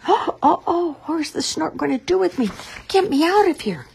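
high, panicky chick voice; a frightened chick begging to be let down; scared baby bird. Acted. Baby bird carried away, scared.

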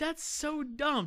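high, slow, drawn-out disgust; a high, slow, disgusted groan of a complaint; exasperated. Real, not acted. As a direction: stretch it out in disbelief at how dumb it is. Ranting about the alternate ending.

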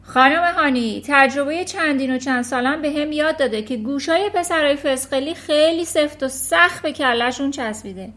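loud dismissive shout; shouting back. Acted. Trunchbull yelling back at Miss Honey dismissively. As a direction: bark back over the noise. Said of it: "ترانچبول هم با فریاد جوابش داد".

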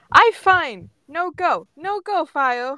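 put-on defiant toddler. A narrator's reading. Ash refusing to leave with Ember, defiant toddler. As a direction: refuse like a stubborn two-year-old. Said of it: "Ash crosses her arms and shakes her head".

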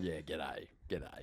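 low, soft, thick Aussie g'day; broad Australian bloke greeting; thick Aussie accent. Acted. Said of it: "like, not thick accent at all".

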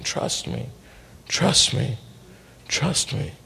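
whispered over and over, barely there. Retold or imitated. God's inner voice to the driver, whispered. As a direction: breathe it like a voice in your heart.

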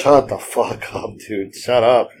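slow, drawn-out exasperated brush-off; fed-up brush-off. Retold or imitated. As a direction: tell him to knock it off, half joking. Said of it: "It's like".

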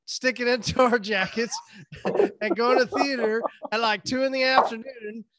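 laughing excitedly. Real, not acted. Giddy story of sneaking food.

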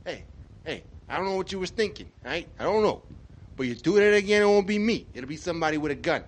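high, loud New York wiseguy threat; tough Italian-American guy. Pretend. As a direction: warn him off like a mob tough guy. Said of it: "an Italian guy or an Irish guy".

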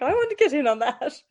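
high, loud, laughing through it; giggling while quoting herself as a kid. Retold or imitated. Retold childish delight, laughing.

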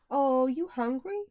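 imitated toddler offering food; little girl playing mom. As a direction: coo it like a pretend mommy. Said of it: "she could be the mom".

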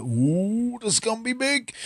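high-pitched mimicked excitement; put-on excited squeal; mock hype. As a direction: imitate giddy pre-fight hype, voice climbing high. Mimics fighters hyped before the bout.

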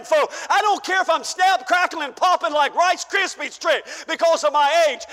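ordinary delivery

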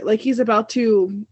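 ordinary talk

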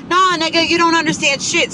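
high-pitched, indignant comeback; angry retort. Retold or imitated. Venting podcaster answering the person who interrupted, indignant. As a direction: cut them off, voice jumping up in anger. Fires back at the retold interrupter.

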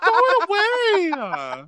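high, slow mom voice; mom ordering. Retold or imitated. Mom tells daughter to toss the hairy food, high and slow. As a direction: drag out the order like a disgusted mom. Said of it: "She's like".

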